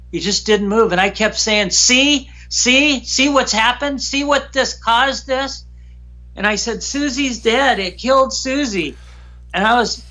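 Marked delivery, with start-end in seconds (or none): 1.7-5.7 higher, pleading, repeating in shock; a hint of anguished insistence; retold distress. Upset showing the dead creature. 6.8-9.0 higher, stunned and upset; a touch of anguished disbelief; retold grief. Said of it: "I was angry and emotional".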